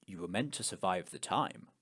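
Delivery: low, quiet, halting pronouncement; solemn cult leader, pausing before the last words; big altar man. Acted. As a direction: intone it low, with heavy pauses. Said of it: "the big man on the altar".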